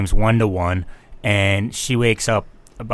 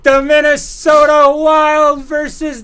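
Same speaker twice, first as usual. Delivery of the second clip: slow, much higher announcer shout; hyping the game like a ring announcer; announcer hype; 5 dB louder, much slower than usual. Acted. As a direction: draw it out like an arena announcer. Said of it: "Okay, let's hear it".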